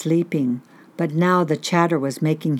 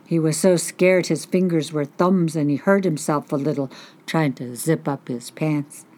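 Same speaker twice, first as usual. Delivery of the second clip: ordinary delivery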